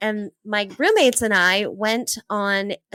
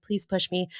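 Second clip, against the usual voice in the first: quiet begging; 4 semitones lower, 7 dB quieter than usual. Retold or imitated. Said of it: "I remember just begging him".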